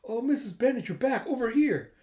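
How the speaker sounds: high, loud hail; calling out. Retold or imitated. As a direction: call over to a returning neighbour. Bystander calling to dog's owner.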